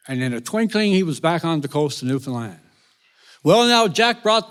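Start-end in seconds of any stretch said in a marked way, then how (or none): none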